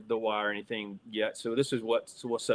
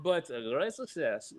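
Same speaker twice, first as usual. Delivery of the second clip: high-pitched comic foreign-accent impression; hammed-up excited impression, laughing through it; Borat; 10 semitones higher, 6 dB louder than usual. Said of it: "Is that Borat?".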